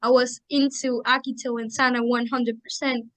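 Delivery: ordinary talk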